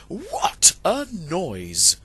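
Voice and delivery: slow amazed outcry. A narrator's reading. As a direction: marvel at the uproar. Narrator marvels at din.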